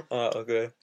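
normal talking voice

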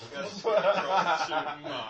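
very loud, drawn-out words through laughter; slow, booming delivery with a laugh; laughing shout. Real, not acted. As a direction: stretch it out loudly while laughing. Shared joke, laughing.

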